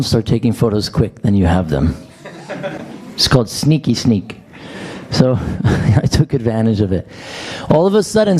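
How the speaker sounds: ordinary talk, nothing marked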